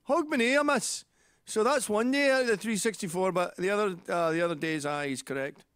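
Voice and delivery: loud high Glaswegian, settling as it goes; imitated Glaswegian. Glaswegian man conceding dad is correct, in Glaswegian accent. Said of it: "I talked to a Glaswegian about that".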